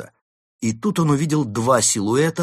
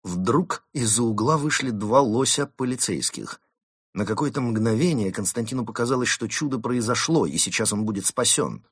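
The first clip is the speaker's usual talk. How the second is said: plain reading voice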